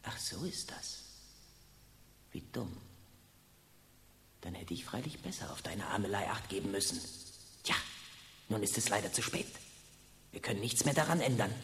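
very soft, low murmur; low, hushed mock regret; hushed musing. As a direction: muse quietly, as if to oneself. Rebuffed rat muses on loss.

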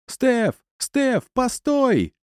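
very high-pitched desperate call, long last word; high, drawn-out shout to stop; yelling. A narrator's reading. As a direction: yell after someone leaving, stretching the last word. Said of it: "заорал я".

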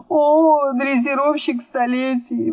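high, imploring cry of address; pleading. A narrator's reading. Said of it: "взмолился великий рассказчик".

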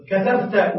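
كتبت ending in -ta, katabta; masculine "you" ending. Shown as a demonstration. Teacher conjugates for masculine "you", كتبت pronounced katabta. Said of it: "وأنت".

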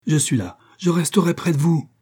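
urgent exclamation. A narrator's reading. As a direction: insist on staying, pitch lifting as it goes. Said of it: "s'écria le lieutenant".

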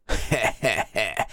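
put-on slow creaking old man's laugh; creaky cackle. A narrator's reading. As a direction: creak out a sinister chuckle. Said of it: "проскрипел".

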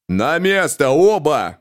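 loud barked order; a colonel's loud parade-ground bark; barked command. A narrator's reading. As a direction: bark them back to their seats. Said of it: "гаркнул Ковалёв".

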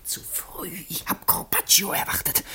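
urgent whisper to oneself; worried whispered aside. Acted. Schemer caught out by early guests, whispered aside. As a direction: whisper it, thinking fast.

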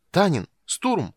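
loud urgent calls, pitch up high; shouting names. A narrator's reading. Paulin shakes his brother, shouting his name in alarm. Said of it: "закричал Полин".

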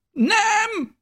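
very high anguished cry; boy's cry. A narrator's reading. Son refuses to leave his mother, crying out. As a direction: wail it out like a distraught boy. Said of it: "kiáltotta Benjamin".

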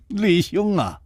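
words spoken through a chuckle; laughing. A narrator's reading. Chu Liuxiang addresses Li, laughing lightly. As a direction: let the laugh spill into the name. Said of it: "楚留香微笑着说".

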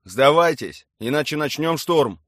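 forceful commander's yell; shouting. A narrator's reading. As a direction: shout an ultimatum at the house. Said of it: "прокричал Юрий".

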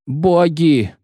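high, wailing moan. A narrator's reading. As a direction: moan it out to heaven. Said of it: "стонал Кевинард Ван Влит". (жалобный стон)

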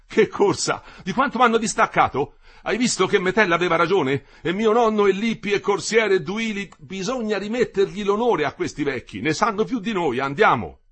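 out of breath from running, fired up; breathless excitement. A narrator's reading. Renzoni arrives running to Parigi, breathless and excited. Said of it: "col fiato grosso".

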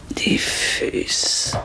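whispered to herself. Acted. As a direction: breathe the words out, unvoiced. Alone, looking at her feet.

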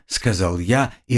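ordinary delivery, nothing marked

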